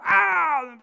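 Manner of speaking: loud, high "Ah" of pain; mock bear howl. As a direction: howl as if hit by a dart. Bear cries out when hit.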